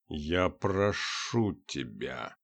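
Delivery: slow, soft, forced through teeth; through gritted teeth. A narrator's reading. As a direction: hiss it through clenched teeth. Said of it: "сквозь зубы процедил Юдин". (сквозь зубы, медленно)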